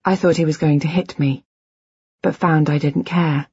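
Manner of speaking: ordinary delivery, nothing marked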